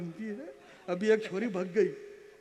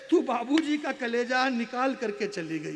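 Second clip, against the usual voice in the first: loud verse falling away at the end; impassioned declamation; 2 semitones higher, 7 dB louder than usual. Acted. Poet reproaching the daughter for breaking her father, loud declamation. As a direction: throw the reproach out to the hall. Repeats the line at full force.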